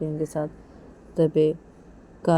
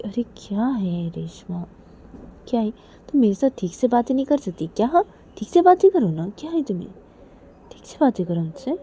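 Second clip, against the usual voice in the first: put-on higher, coaxing girl voice; higher wheedling friend; pleading Kajal; 5 semitones higher than usual. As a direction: coax her to talk nicely. Kajal pleading to talk properly.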